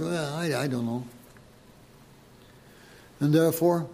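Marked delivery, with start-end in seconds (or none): 0.0-1.0 mumbled, much softer "I don't know"; mock shrug. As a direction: toss it off softly, as if shrugging. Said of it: "will you sort of shrug it off".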